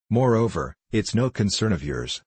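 plain reading voice

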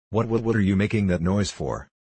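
ordinary delivery, nothing marked

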